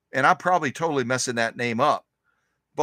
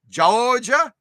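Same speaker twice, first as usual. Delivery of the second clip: mock Southern; 8 semitones higher, 5 dB louder than usual. Playful drawl on Georgia.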